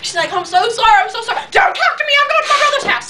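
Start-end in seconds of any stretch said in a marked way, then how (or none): 0.3-1.4 high put-on friend voice; mock frantic apology. 1.5-3.1 very high mock-upset pig; imitated freak-out. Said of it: "he is just freaking out".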